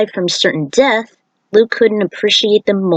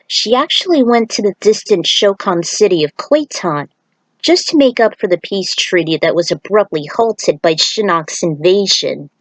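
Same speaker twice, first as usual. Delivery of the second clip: usual speaking voice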